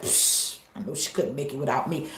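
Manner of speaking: low, soft, scornful brush-off; dismissive scoff. Retold or imitated. Ex scoffs at the struggling parent. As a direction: wave them off with a scoff.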